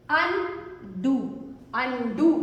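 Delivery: Undo drawn out slowly as अन, then डू; split syllables. Shown as a demonstration. Teacher breaking a word up for pupils, split syllables. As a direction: say "undo" in two separated beats.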